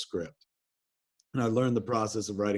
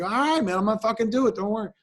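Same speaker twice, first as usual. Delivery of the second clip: high, loud, eager surrender; high-pitched cowed promise; sheepish caving; 12 semitones higher, 4 dB louder, faster than usual. Retold or imitated. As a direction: give in with a squeaky hurry. Retold caving to bully.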